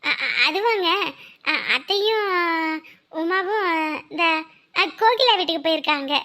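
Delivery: halting, with false starts and pauses; stammering excuse. Acted. Wife covers for in-laws to husband, stammering excuse. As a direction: stall for time while inventing an answer.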